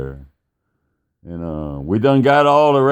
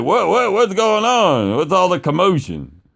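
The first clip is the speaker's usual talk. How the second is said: high-pitched, bewildered questioning; imitated alarmed dad; 8 semitones higher than usual. Dad coming out to screaming kids, bewildered. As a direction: demand to know, voice pitched up.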